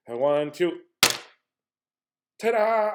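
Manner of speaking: ta-da shouted bright and high; mock magician's flourish on the reveal; magic reveal. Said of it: "Here, I'll do magic".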